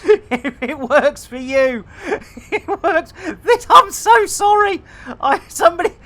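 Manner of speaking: high voice breaking into real laughter; giggling fit. Real, not acted. Streamer apologising to viewers mid giggling fit, laughing. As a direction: try to apologise through helpless giggles. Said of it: "with some laughing gas or something".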